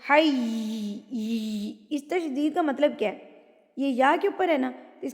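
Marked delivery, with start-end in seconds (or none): 0.1-1.7 حی with the doubled یا pressed hard; حی with a rubbing doubled یا; shaddah on حی. Shown as a demonstration. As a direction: lean into the یا of حی. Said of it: "رگڑ کھاتی ہوئی آواز ہوگی".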